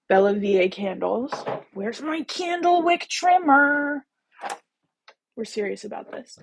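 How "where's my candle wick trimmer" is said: high, drawn-out mock search call; slow, high, theatrical where-is-it call; playful calling out. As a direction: stretch it out high, hunting playfully for it. Playfully calling for a missing tool.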